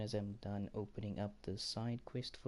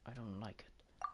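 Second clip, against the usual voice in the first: grumbling whisper; 3 semitones higher than usual. Real, not acted. Grumbling about reused events.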